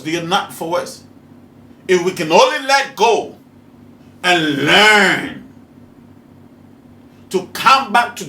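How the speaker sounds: loud, slow, high-pitched preaching; full-force sermon, loud and high; fiery preaching. Acted. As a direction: drive the lesson home from the pulpit.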